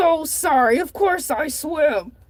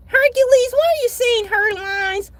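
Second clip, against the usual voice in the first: a scolding grandma, pitched up; 6 semitones higher than usual. Acted. Grandma questions Hercules.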